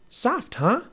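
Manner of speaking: put-on high, teasing young girl; little girl voice. A narrator's reading. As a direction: tease him in a high girl's voice. Narrator voices Annie.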